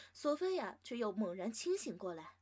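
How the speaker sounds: ordinary delivery, nothing marked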